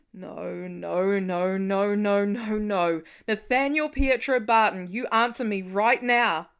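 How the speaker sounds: loud, desperate repeated no building to a command; frantic father, on the edge of tears; panicked pleading. Acted. Father panicking over missing son, loud and desperate.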